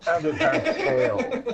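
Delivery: high, breathless giggling line; a real giggle breaking through; laughing. Real, not acted. Reading his story, breaks up laughing.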